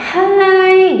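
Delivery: high, loud, held-out "Hai"; excited greeting. Shown as a demonstration. Greeting someone not seen in ages, excited. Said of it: "nyapa excited dong ya".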